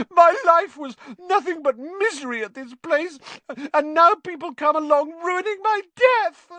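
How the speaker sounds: very high, loud tearful wail; howling. Acted. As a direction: howl in self-pitying misery. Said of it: "howled Myrtle".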